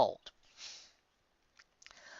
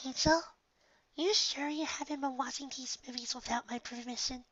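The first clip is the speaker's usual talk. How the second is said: much higher and softer, gentle mare voice; soft Fluttershy; 12 semitones higher, 8 dB quieter than usual. Acted. Fluttershy gently questioning her pet bunny, high and soft. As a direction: ask the bunny gently, barely above a breath.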